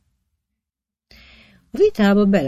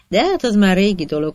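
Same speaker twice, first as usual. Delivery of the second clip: usual speaking voice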